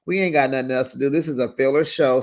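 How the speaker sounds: normal talking voice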